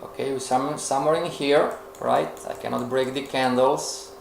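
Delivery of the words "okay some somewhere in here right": ordinary delivery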